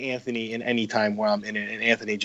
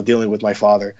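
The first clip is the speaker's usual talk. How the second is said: ordinary delivery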